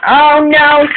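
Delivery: high, loud cry of alarm. Acted. Duncan crashing into the truck, high alarmed cry. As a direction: gasp it out in shock.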